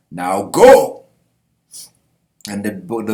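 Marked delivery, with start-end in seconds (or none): 0.1-1.1 loud, commanding send-off; shouted order. Acted. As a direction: shout it as a knight ordering everyone to flee. Skull Knight orders them to flee.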